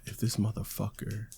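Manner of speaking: hushed, seething aside to oneself; exasperated whisper. Real, not acted. Host fed up as co-host balks at intro, exasperated whisper. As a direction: whisper it, fed up, almost soundless.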